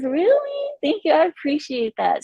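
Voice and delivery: high-pitched, flattered squeal of thanks; delighted surprise. Real, not acted. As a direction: soar up high on the question, then gush thanks. Reacting to a hair compliment.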